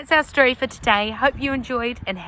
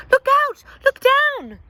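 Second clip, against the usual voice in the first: very high, loud warning shout; storybook alarm cry, very high; urgent yell; 14 semitones higher, 7 dB louder than usual. A narrator's reading. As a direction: yell to warn his sisters. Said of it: "who yelled".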